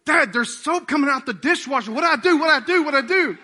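high, loud imitation of a kid in a panic; hammed-up frantic child calling for Dad; panicked daughter on the phone. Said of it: "I get a phone call".

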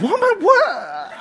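high, slow disbelieving "what"; incredulous. Retold or imitated. Husband staring at his wife, slow incredulous disbelief. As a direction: drag it out in disbelief. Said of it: "looking at her like".

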